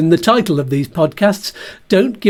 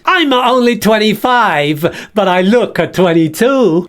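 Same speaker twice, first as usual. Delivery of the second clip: Bert voice; 2 semitones higher than usual. Acted. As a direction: boast vainly about age. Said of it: "This is Bert the Bag".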